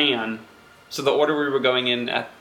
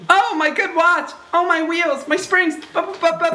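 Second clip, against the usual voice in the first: rabbit wailing for his watch; 13 semitones higher, faster than usual. Acted. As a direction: whimper over the broken watch, sputtering. Rabbit wails over his watch.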